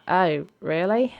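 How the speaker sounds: slow, rising, unimpressed query; negative reaction. Acted. Brandy hearing it went badly, mildly put out. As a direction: mildly put out, drawn-out question. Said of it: "took a negative reaction".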